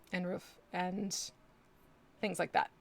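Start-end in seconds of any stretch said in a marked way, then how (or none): 0.3-0.6 roof said the Canadian way, slow; Canadian-ish roof. Shown as a demonstration. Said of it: "the way I say certain words".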